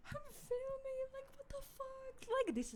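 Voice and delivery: very high, thin retold panic; paranoid. Retelling filming during the knocking, paranoid high squeal. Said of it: "getting all paranoid on the video".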